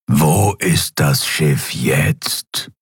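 very slow, low distorted emperor; menacing, warped dark lord. Acted. Emperor demands. (verzerrte, tiefe Herrscherstimme)

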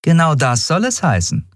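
high, pleased captain's voice; smiling woman captain. Acted. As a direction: confirm it with a smile, pitched high. Captain confirms, having smiled.

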